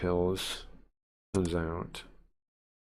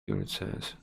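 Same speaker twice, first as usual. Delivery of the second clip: whisper; 14 semitones lower, 9 dB quieter than usual. Real, not acted. Muttering to self.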